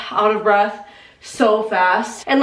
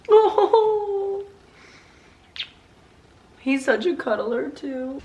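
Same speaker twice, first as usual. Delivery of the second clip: soft, slow coo; high soft coo at the dog; 5 semitones higher, 5 dB quieter, slower than usual. Real, not acted. Cooing to her dog.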